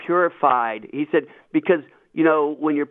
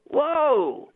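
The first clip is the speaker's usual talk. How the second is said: mock surprise; 13 semitones higher than usual. Mock alarm at the verse.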